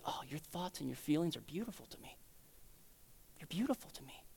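very soft, low, near-whispered comfort; tender reassurance. Acted. God comforting someone who feels poor in spirit, tender near-whisper. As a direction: breathe it gently, as comfort.

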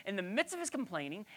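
ordinary talk

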